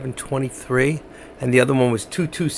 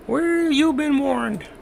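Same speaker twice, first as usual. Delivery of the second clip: high, slow spooky warning; drawn-out eerie announcer voice; ominous voice; 5 dB quieter, much slower than usual. Pretend. Ominous warning before closing bell.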